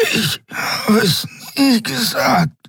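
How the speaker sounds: hohe, dünne, langsame Stimme; dünn wie Nebel. A narrator's reading. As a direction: presse es mit letztem Atem heraus. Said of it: "Seine Stimme war dünn wie Nebel". (dünne, atemlose Stimme)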